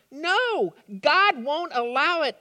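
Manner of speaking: high, loud protest from Peter; anguished cry. A narrator's reading. Peter crying out against Jesus, anguished. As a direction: cry out refusing the news. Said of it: "Peter cried".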